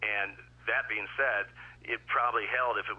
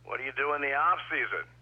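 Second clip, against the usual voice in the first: heckler yell; 6 semitones higher, 5 dB louder than usual. Retold or imitated. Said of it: "And he goes".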